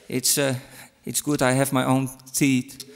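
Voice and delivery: usual speaking voice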